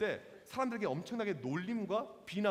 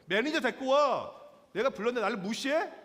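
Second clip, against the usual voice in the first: loud, raised-pitch mock outrage; angry shouting; 3 semitones higher, 6 dB louder than usual. Young father berating restaurant staff, imitated angry shouting. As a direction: demand the manager, deeply affronted. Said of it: "막 소리를 지르는 거예요".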